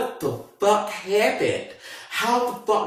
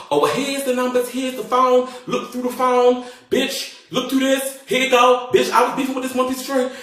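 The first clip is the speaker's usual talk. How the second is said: high, rushed panicky pleading; freaking out; 8 semitones higher than usual. Retold or imitated. Handing the detective the phone in freak mode, high and panicked. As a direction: babble it out in a panic. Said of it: "I start going into freak mode".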